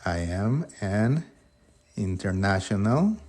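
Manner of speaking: slow, careful English; slow model sentence. Shown as a demonstration. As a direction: spell it out slowly for students. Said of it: "Sería".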